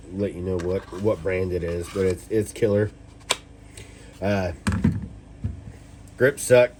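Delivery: usual speaking voice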